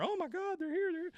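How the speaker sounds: excited crowd. Retold or imitated. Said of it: "The crowd starts to murmur".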